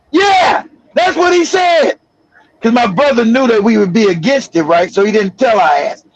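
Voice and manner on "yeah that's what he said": high, emphatic retort; indignant confirmation. Retold or imitated. Mom confirms the marriage to her son, indignant. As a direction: imitate a worked-up mother confirming it.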